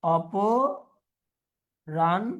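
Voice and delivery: অপরাহ্ন stretched very slowly, ending on a rise; teacher modelling অপরাহ্ন slowly; slow demo pronunciation. Shown as a demonstration. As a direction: draw out অপরাহ্ন for the class. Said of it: "লিখে দিছে অপরাহ্ন".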